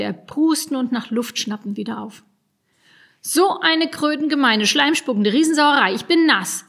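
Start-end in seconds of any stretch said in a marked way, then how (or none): 3.2-5.9 loud, high, spluttering rage; furious outburst. Acted. Dripping goblin curses at the boys, enraged. 5.9-6.6 loud, high, indignant complaint. Acted. Dripping goblin complains to the boys, indignant.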